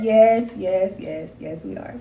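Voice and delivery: ordinary talk, nothing marked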